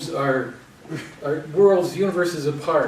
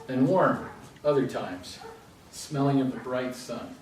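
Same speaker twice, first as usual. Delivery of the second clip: measured recital, settling softer at the close; poetry reading; 4 dB quieter than usual. Acted. Poet reads own poem to the audience, measured recital. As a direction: let the lines unfold with pauses and wind down.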